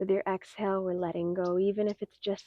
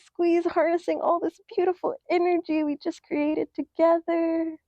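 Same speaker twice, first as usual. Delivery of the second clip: higher, louder, building push; energetic coaching; 8 semitones higher, 5 dB louder than usual. Acted. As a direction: pump them up to squeeze hard. Coaching a big squeeze.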